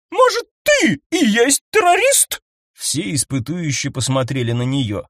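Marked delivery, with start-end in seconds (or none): 0.1-2.4 Eleonora shrieks at Fox's bossiness; outraged shout. A narrator's reading. Eleonora yells at Fox, accusing him. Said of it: "выкрикнула Элеонора".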